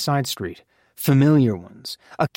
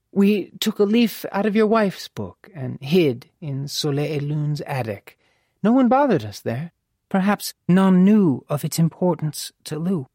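higher-pitched lady's character voice; composed mother; 4 semitones higher than usual. Acted. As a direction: explain with poise in a raised mother voice.

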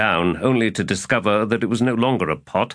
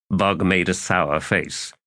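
ordinary delivery

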